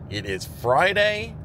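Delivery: ordinary talk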